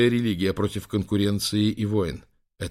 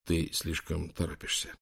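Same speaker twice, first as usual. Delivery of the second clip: quiet self-talk, softer; steady yourself, hush it down; calming himself; 4 semitones lower, 8 dB quieter than usual. A narrator's reading. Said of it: "приказал себе успокоиться". (тихо, успокаивая себя)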